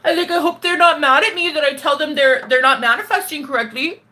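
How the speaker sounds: high, fretful, hammed-up timidity; worried little whine in mockery; mock scared self. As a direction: parody your own anxious people-pleasing. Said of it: "the version I've been lately is like".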